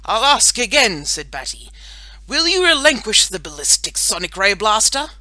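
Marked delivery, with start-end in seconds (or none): 0.0-1.1 loud, high and commanding; superhero demand. A narrator's reading. Boy superhero questions a silent villain, commanding. 2.3-5.1 loud, grandly declaimed question; superhero demand. A narrator's reading. Boy superhero orders scientist to give up weapon, commanding.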